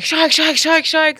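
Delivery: high, rapid-fire warning cry; retold scream. Diver warning friends of bull sharks, retold scream. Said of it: "I start screaming to my friend".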